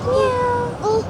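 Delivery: high-pitched cat meow to lure the kitty; high meow call. Acted. Woman calling a stray cat, high meowing.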